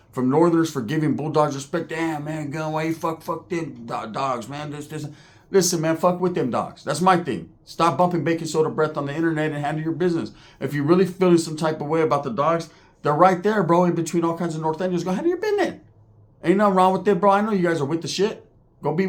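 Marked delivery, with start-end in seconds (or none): 1.9-5.1 softer, stumbling imitation of complainers; mock grumbling. Northerners griping about the Bulldogs, softer mock grumbling. Mimicking hateful online complainers. 15.0-15.8 goading, in a very high voice; high-pitched taunt. Real, not acted. Vlogger daring online talkers, high-pitched taunt.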